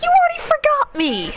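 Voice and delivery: very high, squeaky teasing voice; teasing punchline. Acted. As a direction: deliver the punchline in a silly high voice. Punchline of the joke.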